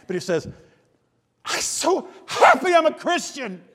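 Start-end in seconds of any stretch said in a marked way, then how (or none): 1.4-3.6 high, slow and loud, bursting with joy; excited friend. Retold or imitated. Preacher imitating his excitable friend John at dinner, high joyful gush. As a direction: gush it like you can't contain your happiness. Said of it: "Can you kinda hear him saying that, that way".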